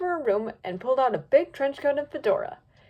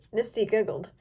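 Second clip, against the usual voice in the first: ordinary delivery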